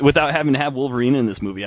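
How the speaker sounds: ordinary delivery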